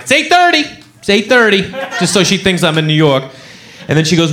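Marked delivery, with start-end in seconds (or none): 0.0-1.6 lying. Retold or imitated. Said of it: "I lie, and I'm like".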